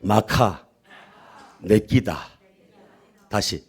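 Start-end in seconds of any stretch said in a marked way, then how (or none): 0.0-2.3 Gyeongsang dialect in call-and-repeat pieces; lower, measured Gyeongsang-accented lead for repeating; feed it phrase by phrase in Gyeongsang dialect. Shown as a demonstration. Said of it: "경상도 버전으로".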